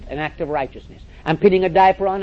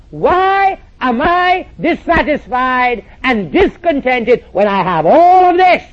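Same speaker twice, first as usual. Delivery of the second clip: slow, drawn-out plea, higher and louder; dragged-out, louder plaintive cry; quoted preacher's lament; 6 semitones higher, 8 dB louder, slower than usual. Retold or imitated. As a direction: voice the pastor's anguished question. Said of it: "said in front of his entire staff, asking me the question".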